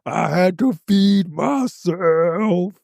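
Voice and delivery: high, slow mocking quote of Aesop; quoting the catchphrase. Friends repeating Aesop's line, high slow mocking quote. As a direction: drag it out like the old inside joke. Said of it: "we say".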